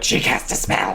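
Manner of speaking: breathy hushed spell accusation; whispered claim. Retold or imitated. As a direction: whisper it like a secret. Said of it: "on board with, like".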